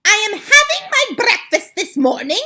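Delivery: very high, loud, furious screech; the Grand High Witch rages at her coven over the children; screaming witch. Acted. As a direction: shriek with disgust at the children. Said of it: "she screamed".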